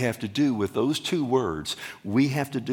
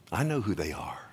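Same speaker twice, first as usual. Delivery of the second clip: whisper; 4 semitones lower, 5 dB quieter, faster than usual. Acted. Quoting Jesus in a hush.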